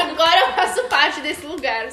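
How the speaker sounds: laughing loudly through the words. Real, not acted. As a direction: burst out laughing while saying it. Feliz contando sobre o festival. (rindo alto)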